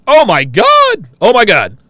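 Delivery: excited outburst. Real, not acted. Reacting to oatmeal pies.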